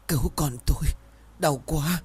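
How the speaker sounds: high-pitched, restrained wail of pain; desperate cry. A narrator's reading. Labouring mother begs for her child's life, lightly acted desperate cry. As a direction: plead in agony without real shouting. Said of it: "bấy giờ gào lên".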